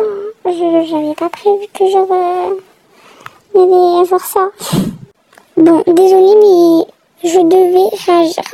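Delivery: ordinary talk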